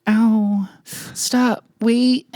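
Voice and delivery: tiny high squeal, word by word; joking high-pitched whimper; mock climax. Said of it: "you know what I sound like when I come".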